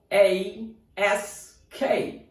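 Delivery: slow, separate letter names; spelling out. Shown as a demonstration. Teacher spelling another word for learners, spelling out slowly. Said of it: "another word".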